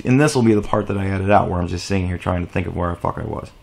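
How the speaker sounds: low, soft, fast mumble to self; quiet, rapid throwaway aside; muttered aside. Real, not acted. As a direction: talk under your breath while thinking. Aside while losing train of thought.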